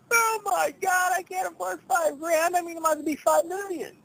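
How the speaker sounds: mock shocked. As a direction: gasp it out in a hammed-up high voice. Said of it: "So then you're like".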